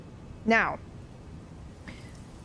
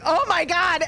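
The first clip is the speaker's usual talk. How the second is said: high, louder alarmed gasp; 7 semitones higher, 3 dB louder than usual. Retold or imitated. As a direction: say it as fear sets in. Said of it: "I was like".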